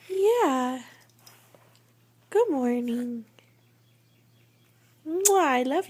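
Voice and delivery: high, slow doting voice. Real, not acted. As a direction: gush over the cute dog. Gushing over dog.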